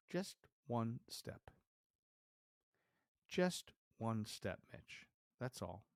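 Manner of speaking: slow, measured self-talk; thinking to oneself. Retold or imitated. Climber coaxes himself onto the pole, slow self-talk. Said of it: "And I think to myself".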